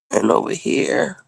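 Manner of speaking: soft, half-whispered aside; quietly narrating own first aid; murmured to self. Real, not acted. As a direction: murmur it while busy. Dabbing ointment on scab.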